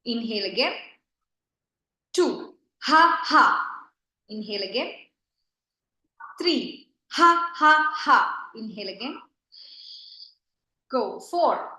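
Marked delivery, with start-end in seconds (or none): 2.8-3.6 loud open-mouthed ha ha; forced laughter. Shown as a demonstration. Teacher leading laughter yoga, loud forced laugh. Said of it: "Open your mouth". 7.1-8.5 loud ha ha with the mouth wide open; forced laughter. Shown as a demonstration. Teacher leading laughter yoga, loud forced laugh. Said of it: "Open your mouth".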